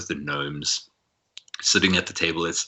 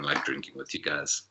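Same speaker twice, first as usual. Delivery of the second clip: ordinary delivery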